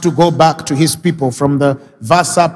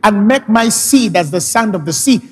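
fervent pleading; 5 semitones higher than usual. Acted. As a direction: hold God to His word, passionately. Jacob pressing God's promise in prayer.

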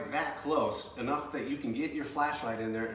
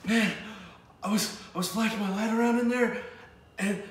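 high, rattled voice getting louder; imitating a shaken friend; shaken retelling; 7 semitones higher, 6 dB louder than usual. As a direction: still spooked by what he saw. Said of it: "he's like".

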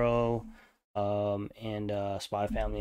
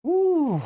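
a sudden high, loud whoop; excited whoop; 5 dB louder than usual. Real, not acted. Gamer hyped by the dance trailer, loud high whoop. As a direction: let out a hyped woo.

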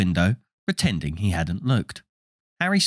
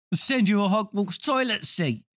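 put-on cheeky boy voice; 10 semitones higher, 3 dB quieter than usual. Twin jokes to sister.

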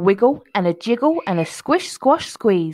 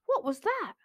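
high, hushed alarm; startled worried question; 12 semitones higher, 6 dB quieter than usual. A narrator's reading. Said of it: "cried Mouse".

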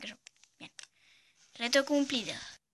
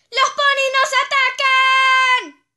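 high, slow, full-volume alarm shout; 12 semitones higher, 13 dB louder, slower than usual. Pretend. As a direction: scream it like the ponies are really coming. (grito de alarma fuerte y agudo)

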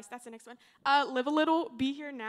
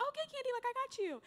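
high-pitched, reassuring imitation; reassuring Vicky; 13 semitones higher, much faster than usual. Vicky soothing a student who asked for help, high-pitched reassurance. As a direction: put on a much higher voice to reassure. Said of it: "And she'll be like".

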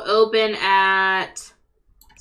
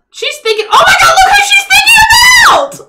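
squealing high with glee; excited shouting; 10 dB louder, much faster than usual. Real, not acted. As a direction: shriek it out in delight. Excited shipping of game characters.

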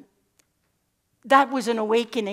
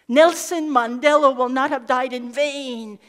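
proclaimed oath; 4 semitones higher, 10 dB louder than usual. Pretend. Speaker declaring the oath to the audience, loud. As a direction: proclaim it like a vow.

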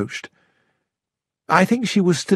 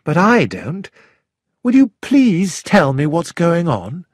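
coldly; 6 semitones higher than usual. A narrator's reading. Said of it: "I said coldly".

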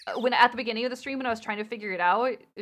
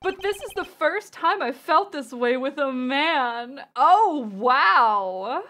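swooning, louder on the wow; flamboyant voice; 3 semitones higher, 5 dB louder, slower than usual. Acted. Game character smitten with a man for the first time, flamboyant. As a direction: gush with flamboyant flair. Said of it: "a flamboyant voice".

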